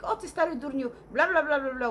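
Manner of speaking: retold rant trailing into loud blahs; shouting in Polish. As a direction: imitate grandmother ranting in Polish. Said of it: "starts shouting in Polish".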